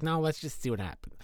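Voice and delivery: a lightly voiced calm wife; generic wife voice. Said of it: "That's my generic wife voice".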